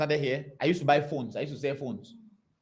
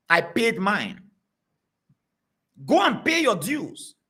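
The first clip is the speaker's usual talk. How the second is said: usual speaking voice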